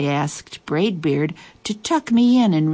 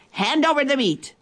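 pirate demand; 9 semitones higher than usual. A narrator's reading. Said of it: "Braidbeard gulped his food and said".

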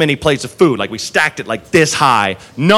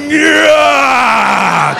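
straining yell; 7 semitones higher than usual. Retold or imitated. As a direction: grunt it out like lifting a huge weight. Busboy lifting the tray.

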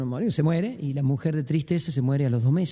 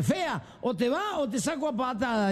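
higher-pitched comic threat; mock threat; 6 semitones higher than usual. Telling the drunk to leave or be kicked out, mock angry. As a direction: threaten to kick them out, hammed up.

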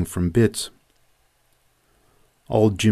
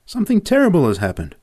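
frightened rush; 8 semitones higher, faster than usual. A narrator's reading. Boys panic at stampede.

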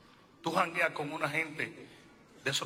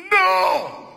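a cry of no, much higher and louder; sharp no; 12 dB louder than usual. Acted. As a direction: cut the excuse off with one loud no. Preacher rejects the excuse.

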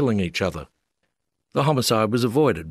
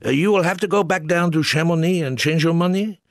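put-on higher, French-accented official voice; 8 semitones higher, 3 dB louder than usual. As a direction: lay down the rule in a French accent.

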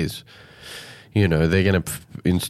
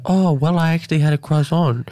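higher, lightly put-on voice of a politician answering; mock innocent answer, pitched up; Josh's reply; 7 semitones higher than usual. Host imitating the minister answering about breakfast, hammed higher voice. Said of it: "he's like".

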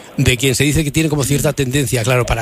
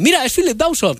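higher, impressed aside; admiring; 9 semitones higher than usual. Retold or imitated. Guests noticing the actor, mildly admiring. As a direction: point him out with admiration. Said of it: "con cierta admiración".

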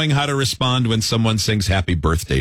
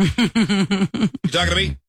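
mock tough guy; 6 semitones higher, slower than usual. Slow mock question, far.

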